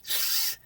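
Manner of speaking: "th" as a soft, voiceless stream of air; th sound demo. Shown as a demonstration. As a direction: show a child the sound "th" on its own. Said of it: "You show them".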